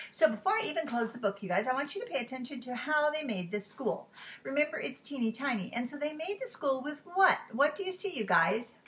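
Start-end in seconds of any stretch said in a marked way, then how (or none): none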